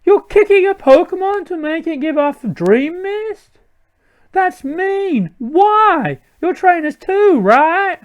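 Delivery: very high put-on girl voice; indignant girl. Girl scolding villains for kicking Pokemon, indignant high voice. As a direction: protest shrilly like an outraged girl.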